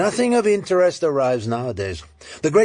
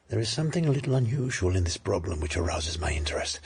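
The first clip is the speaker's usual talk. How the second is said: hushed and low, drawn into the mystery; intrigued whisper; 6 semitones lower, 6 dB quieter than usual. Acted. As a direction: lean in and whisper it, intrigued by the case. Detective intrigued, confiding.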